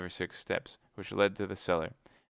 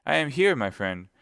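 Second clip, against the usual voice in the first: shouted yes, much higher and louder; raised voice; 5 semitones higher, 7 dB louder than usual. Acted. D'Artagnan shouts to Athos behind the cellar door. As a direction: call out so Athos hears. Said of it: "raising his voice in turn".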